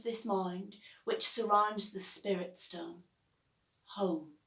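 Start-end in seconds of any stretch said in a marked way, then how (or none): none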